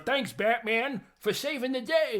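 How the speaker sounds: high, loud cartoonish commissioner voice; commissioner impression. Host playing the commissioner thanking Batman, hammed-up high voice. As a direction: call it out high and hammy. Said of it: "the commissioner goes".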